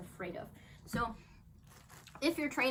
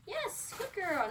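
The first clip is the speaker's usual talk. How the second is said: higher, stretched praise; drawn-out yes for the dog; slow high praise; 9 semitones higher, much slower than usual. Real, not acted. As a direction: reward her gently but happily.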